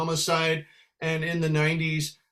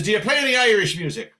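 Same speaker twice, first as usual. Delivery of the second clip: loud, quick, higher demand; challenging regular; 5 semitones higher, 5 dB louder, faster than usual. Retold or imitated. Pub regular grilling musician, loud quick challenge. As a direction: fire the question at him. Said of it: "he says".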